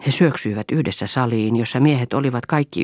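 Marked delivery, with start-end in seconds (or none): none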